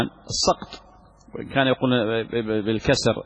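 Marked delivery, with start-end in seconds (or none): 0.0-0.8 "السقط" pronounced as-saqt; short open "a" after the s in "السقط"; fatha on the sin. Shown as a demonstration. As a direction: say "السقط" as saqt, not siqt. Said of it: "بفتح السين".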